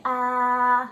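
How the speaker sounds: open-mouthed aah with tongue out; tongue out saying aah. Shown as a demonstration. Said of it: "stick out your tongue and say".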